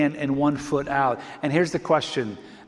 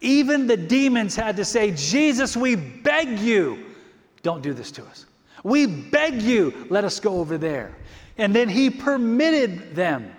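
impassioned preaching; 4 semitones higher, slower than usual. Acted. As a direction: plead as the demons before Jesus. Dramatized demons pleading, preached.

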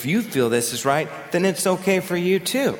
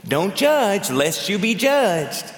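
snarky; 4 semitones higher, slower than usual. Retold or imitated. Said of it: "done in a snarky way".

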